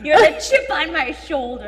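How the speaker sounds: shouting. Acted. Shouted reply.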